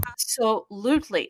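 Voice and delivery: normal talking voice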